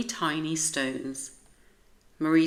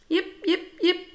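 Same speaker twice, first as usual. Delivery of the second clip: very high yappy yips; small dog yipping; 14 semitones higher, 4 dB louder than usual. A narrator's reading. Tiny sparkly dog yips at Rosie, very high and yappy. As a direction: yap like a tiny excited dog.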